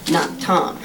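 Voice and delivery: ordinary delivery, nothing marked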